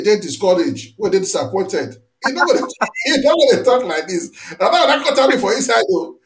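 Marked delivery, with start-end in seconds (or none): 2.2-6.2 laughing. Real, not acted. Host laughing at the rumor.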